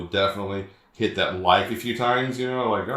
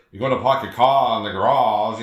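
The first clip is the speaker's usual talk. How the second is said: louder, demonstrative Boston accent; mock a Boston accent with dropped r's; 5 dB louder than usual. Shown as a demonstration. Podcast host showing his co-host a Bostonian accent, Boston accent. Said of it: "the Boston is like".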